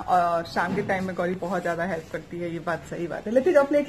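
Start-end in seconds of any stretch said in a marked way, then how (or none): 3.3-3.9 raised call across the house. Real, not acted. Calling to the family to take the plates, raised call.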